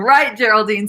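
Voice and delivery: normal talking voice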